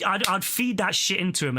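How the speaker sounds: ordinary delivery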